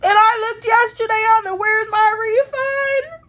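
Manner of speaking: high, tearful amazement; crying gratefully. Real, not acted. Woman tells viewers of refund arriving for rent, crying gratefully. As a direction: voice lifted and wailing through real tears. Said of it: "I'm crying because God is good".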